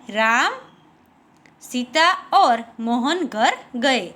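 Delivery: राम held alone, long pause, then the rest slowly; pause after राम. Shown as a demonstration. Teacher demonstrating where the comma falls, clear pause after राम. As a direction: stop briefly after saying राम, then continue deliberately. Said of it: "बोलते ही थोड़ी देर रुकते हैं".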